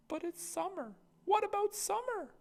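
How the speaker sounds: put-on a small boy pleading; little brother.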